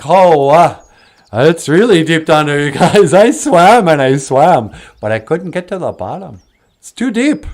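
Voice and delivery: animated animal character reporting back; otter's exclaiming. Acted. Exhausted otter reports back to friends.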